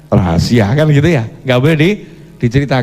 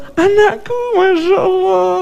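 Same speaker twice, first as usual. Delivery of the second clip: put-on much higher, cooing over a little child; a parent fawning, pitched way up; delighted doting father. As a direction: gush over your kid on a video call.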